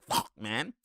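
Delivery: soft, breathy mock curse; under his breath. Player Ojale cursing at himself after the mistake, under his breath. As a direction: mutter it like you know you messed up. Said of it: "He's like".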